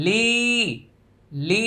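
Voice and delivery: "লি" held long; three-alif stretch. Shown as a demonstration. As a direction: draw out the vowel of "লি". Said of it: "লি হচ্ছে তিন আলিফ টান". ("লি" তিন আলিফ টেনে)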